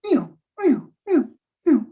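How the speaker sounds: high wobbly iu, iu like a swaying plane; swinging sound. Retold or imitated. Pilot recreating his powerless plane swinging, high wobbling sound. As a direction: mimic the plane swaying helplessly.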